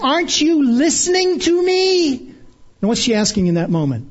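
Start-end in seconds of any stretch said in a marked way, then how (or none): 0.0-2.3 put-on high, strained mother scolding her child; a mom losing her patience with a child who won't listen; exasperated mother. Exasperated mother scolding a child who won't listen, voice jumping high. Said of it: "trying to control her exasperated tone and not always succeeding".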